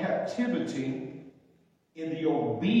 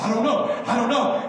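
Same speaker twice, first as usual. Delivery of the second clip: high, loud, bewildered reply; startled; 6 semitones higher, 6 dB louder than usual. Retold or imitated. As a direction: woken up and confused, blurting it. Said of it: "I said".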